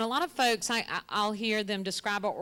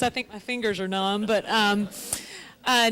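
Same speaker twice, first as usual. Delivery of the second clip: shivering; 7 dB louder than usual. Real, not acted. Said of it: "I'm so cold".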